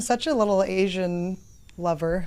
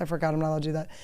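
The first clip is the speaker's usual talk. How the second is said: usual speaking voice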